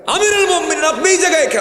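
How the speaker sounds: very high, shouted surprise; startled judge. Acted. The judge shocked to see the caliph in his court, shouting. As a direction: jump up amazed at who walked in. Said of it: "বিচারপতি দেখা মাত্রই দাঁড়ায় গেছে".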